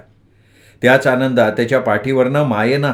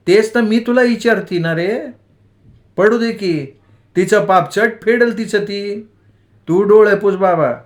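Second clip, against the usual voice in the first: higher, earnest mother reasoning; lightly voiced wise mother; 8 semitones higher than usual. A narrator's reading. Mother reasoning with her hurt son, higher earnest voice. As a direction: talk sense into him like a village mother.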